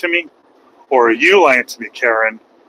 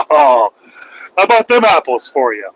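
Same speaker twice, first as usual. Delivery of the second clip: ordinary talk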